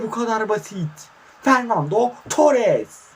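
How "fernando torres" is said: drawn-out, much higher and louder name call; commentator goal call. Real, not acted. Calling the striker's name as he shoots, slow and soaring. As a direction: stretch the name like a stadium announcer.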